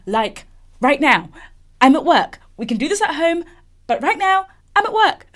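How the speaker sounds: pleading that climbs high and loud; mock inner panic. Talking to herself about crying at work, mock panicked. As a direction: scold yourself with rising urgency, tipping into laughter.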